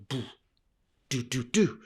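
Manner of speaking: mock boxing punches; boxing jab sounds. Shown as a demonstration. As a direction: throw the sounds like quick punches. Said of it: "a little bit like I'm into a fight".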